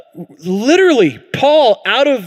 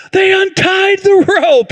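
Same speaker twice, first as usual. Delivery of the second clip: high, slow alarmed cry; panicked realization; 13 semitones higher, 4 dB louder, slower than usual. Retold or imitated. Dad panicking as the raft drifts away, high alarmed cry.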